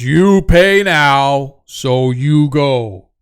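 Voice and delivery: slow, loud giant voice; demanding giant. Acted. Giant demanding payment from travelers, slow and loud. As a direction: boom it out slowly like a dim giant.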